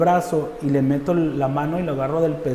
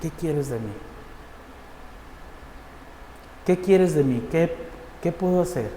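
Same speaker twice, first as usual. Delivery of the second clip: soft, falling questions with long pauses; retold plea to God; 7 dB quieter than usual. Prisoner questions the Lord in jail, subdued retold plea. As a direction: ask God quietly, leaving space after each question. Le pregunté al Señor.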